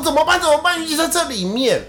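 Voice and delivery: high, loud flustered panic; frantic, not knowing what to do; panicked. Retold or imitated. Sister hears her missing cat inside, high loud panic.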